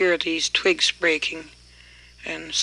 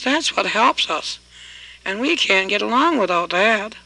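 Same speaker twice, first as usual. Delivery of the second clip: loud, higher pleading shout; hollering; 3 semitones higher, 5 dB louder than usual. Acted. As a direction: yell it after him, desperate to get it back. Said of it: "that man hollered".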